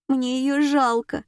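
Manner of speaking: high, slow, tearful voice; sobbing pity. A narrator's reading. Morgunova pities her dead aunt, acted sobbing. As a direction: draw it out plaintively, on the edge of sobs. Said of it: "всхлипнула Моргунова".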